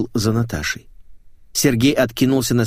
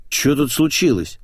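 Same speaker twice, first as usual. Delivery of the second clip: higher, mildly alarmed question; a touch of concern; worried; 5 semitones higher than usual. Acted. Employee asking the men what happened, worried. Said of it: "забеспокоилась Капустина".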